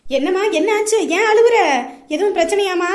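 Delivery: loud, worried questioning; alarmed concern. Acted. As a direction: ask urgently what is wrong. Mother hears daughter crying.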